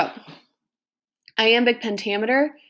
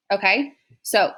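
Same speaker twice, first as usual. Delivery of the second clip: ordinary delivery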